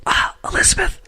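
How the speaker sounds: high, hushed, then a quick prompting voice; mock director reminder. Director reminds the actress of her accent in a high hushed voice. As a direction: whisper a nudge to the actress like a director on set. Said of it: "was like".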